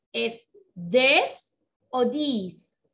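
"this" drawn out very slowly, two vowel sounds set apart; slow contrast demo. Shown as a demonstration. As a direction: spell out each "this" slowly for the child. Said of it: "hay una diferencia en la pronunciación". ("¿es this o this?" muy lento, marcando la diferencia)

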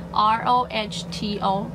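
slow, letter-by-letter spelling; spelled out. Real, not acted. Vlogger reads a brand name to viewers, spelled out slowly.

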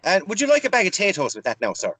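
mock Irish shop clerk offering extras; Irish accent. Irish shopkeeper asking the customer, in a mock Irish accent. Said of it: "I'm talking in an Irish accent now".

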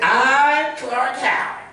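too dynamic, pretty Clark voice; overdone bright announcer take on Clark; Clark Howard impression. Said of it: "you made him sound too dynamic".

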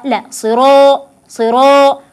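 صراء said slowly with a full, heavy alif. Shown as a demonstration. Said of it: "أفخم الألف".

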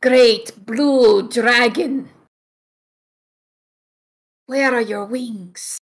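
kobold leader. Acted. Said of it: "and he's like".

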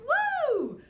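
excited cheer. Real, not acted. Cheer for presents.

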